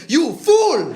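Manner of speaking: outraged heckler. Retold or imitated. Man standing up to scold comedian, outraged. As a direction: hurl it out like an affronted audience member.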